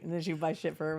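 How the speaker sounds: ordinary talk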